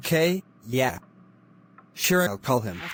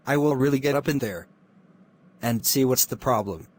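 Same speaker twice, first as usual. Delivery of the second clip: ordinary delivery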